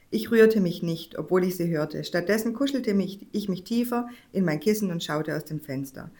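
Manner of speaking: plain reading voice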